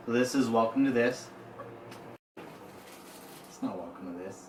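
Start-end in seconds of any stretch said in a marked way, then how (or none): 2.4-4.5 slow, breathy whispered correction; mock secret whisper. Co-host hisses the wrong show title back, slow mock whisper. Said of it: "It's Listen to This".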